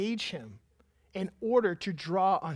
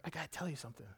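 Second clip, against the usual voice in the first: mock whisper; 4 semitones lower, 12 dB quieter, much faster than usual. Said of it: "she whispers".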